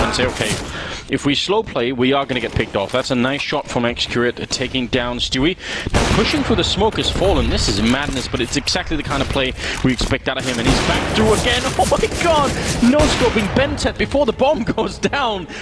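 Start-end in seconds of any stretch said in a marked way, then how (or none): 5.9-15.5 hyped esports caster. Acted. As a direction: lose it over the play. Caster hypes Fallen's play.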